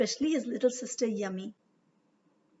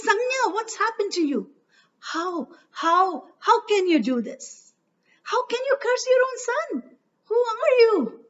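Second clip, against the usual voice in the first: much higher, loud anguished questioning; shocked father; 11 semitones higher, 7 dB louder than usual. A narrator's reading. Surya horrified at his wife cursing their son. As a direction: beg her to explain, aghast.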